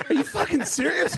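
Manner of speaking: high-pitched laughing disbelief; incredulous. Retold or imitated. Comic hearing rich Eddie won't pay for cable, incredulous. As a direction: question him in comic astonishment.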